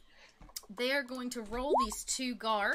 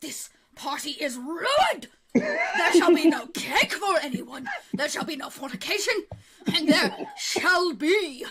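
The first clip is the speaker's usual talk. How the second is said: slow, loud, high royal tantrum; flustered king; 6 semitones higher, 7 dB louder, slower than usual. Acted. King cancelling his ruined party, flustered proclamation. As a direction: proclaim each ban in a flustered fury. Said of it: "he kinda gets very flustered".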